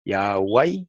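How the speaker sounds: "Yahweh" said as ya-WAI, ending in a diphthong; variant reading. Shown as a demonstration. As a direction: sound out "Yahweh" as ya-wai, low and rising. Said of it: "se lee así como lo voy a leer".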